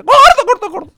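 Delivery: very high, loud gobble of words; gobbling turkey. Acted. The turkey, like his late wife, gobbles at him, very high and loud.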